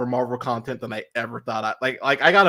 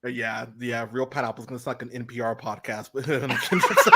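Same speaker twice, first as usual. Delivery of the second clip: usual speaking voice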